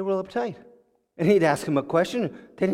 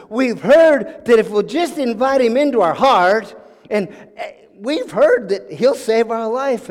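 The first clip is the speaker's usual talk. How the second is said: slow, raised, emphatic assurance; eager conviction; 4 semitones higher, 6 dB louder, slower than usual. Acted. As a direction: stretch out the good news with conviction. Advisors explain hope with eager conviction.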